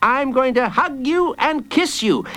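put-on high-pitched child's voice; bold child. Jacob makes his threat to Hooded Fang, bold. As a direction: bargain boldly in a child's high voice.

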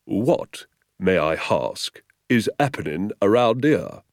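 slow, self-important questioning; pompous policeman. A narrator's reading. Sergeant addressing the crowd at the station, pompously. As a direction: chest puffed, ask it with authority. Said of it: "puffing out his chest".

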